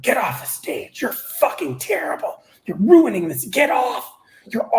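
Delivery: high, sharp mock heckling that builds to a shout; retelling furious women yelling at him; imitated angry hecklers. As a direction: spit the insults back like the hecklers did. Hecklers screaming at comedian on stage.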